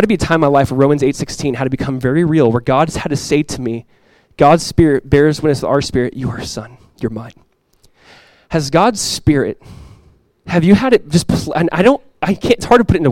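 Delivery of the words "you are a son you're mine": very soft, low voice; hushed, tender assurance from a father; intimate reassurance. Acted. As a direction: drop down low and gentle. God speaking intimately to the preacher.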